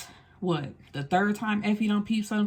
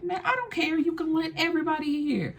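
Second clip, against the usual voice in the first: imitating Effie; 9 semitones higher than usual. Effie defies Curtis publicly.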